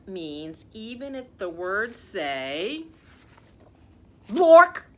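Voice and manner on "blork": loud silly word. Acted. Absurd word forced to read.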